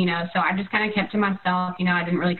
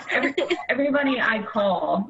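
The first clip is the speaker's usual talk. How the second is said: ordinary talk, nothing marked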